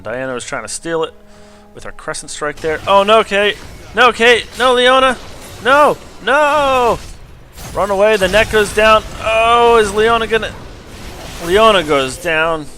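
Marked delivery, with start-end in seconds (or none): 2.9-12.7 higher, louder cries of no at the teammates; frantic play-by-play. Acted. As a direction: shout the fight as it falls apart. Live play-by-play of replay.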